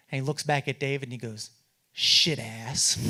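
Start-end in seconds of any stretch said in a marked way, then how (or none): 1.9-3.0 low, blunt put-down; a low, gruff mock of the Speaker; gruff insult. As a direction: spit it out in a lower, gruff voice. Speaker insults David in elevator.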